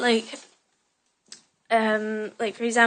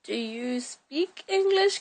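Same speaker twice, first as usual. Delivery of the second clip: slow, overly careful English tourist asking; slow tourist question; 3 semitones higher, much slower than usual. Retold or imitated. Imitating English tourists asking her, slow and deliberate. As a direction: spell it out like a tourist abroad. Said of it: "in that kinda, kinda way".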